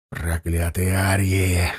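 low, slow hiss of hatred; venomous, drawn-out low curse. A narrator's reading. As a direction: hiss the curse softly and slowly. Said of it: "зашипел гусляр".

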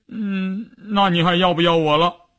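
high, whimpering question; acted tearful hum, then a plea; tearful pleading. A narrator's reading. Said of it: "一边哭， 一边抽打着说".

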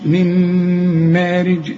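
من مارج very slow, nun turned to a nasal mim with closed lips; slow tajweed chant; recited with ghunna. Shown as a demonstration. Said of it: "هقفل شفايفي هنا".

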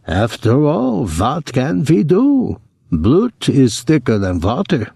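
put-on imagined brother's German accent. A narrator's reading. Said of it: "that ineradicable German accent".

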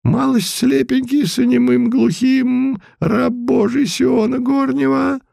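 put-on high mumbling old man voice; toothless mumble. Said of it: "и прошамкал".

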